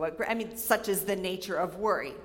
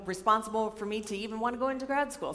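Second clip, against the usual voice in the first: ordinary delivery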